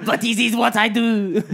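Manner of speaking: laughing cartoon Frenchman; French accent. Ticket seller excuses himself, mock French accent. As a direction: protest in a silly French accent. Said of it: "in his French accent".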